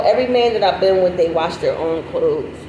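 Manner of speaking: ordinary talk, nothing marked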